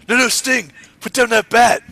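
high, loud mock alarm; hammed-up frightened pleading; Triple H impression. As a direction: yell it in comic panic. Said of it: "you do the best, uh, Triple H".

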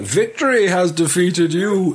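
quoted villain line. Acted. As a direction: savour each word like a grand villain. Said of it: "I've wrote this bit in as well".

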